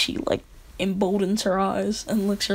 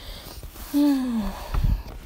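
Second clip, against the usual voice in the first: long, weary sigh; 3 semitones higher, 4 dB quieter than usual. Real, not acted. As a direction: let out a drawn-out sigh. Dreading the next recording.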